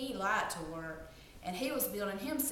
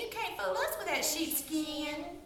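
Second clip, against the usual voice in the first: little pigs; 9 semitones higher than usual. Acted. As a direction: squeal back at the wolf. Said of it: "And they said".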